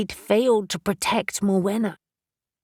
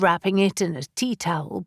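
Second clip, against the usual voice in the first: ordinary delivery, nothing marked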